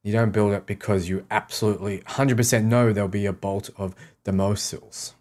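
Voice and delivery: normal talking voice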